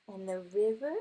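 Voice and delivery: ordinary delivery, nothing marked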